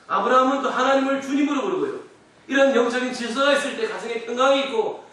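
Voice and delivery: high, ringing sermon voice; forceful preaching. Real, not acted. Pastor teaching order in marriage, forceful preaching.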